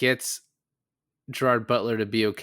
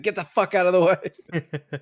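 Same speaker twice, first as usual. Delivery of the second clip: imitating a gangster barking, higher and louder; play-shout at the workers to clear off; mock yell; 9 semitones higher, 6 dB louder than usual. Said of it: "He's like".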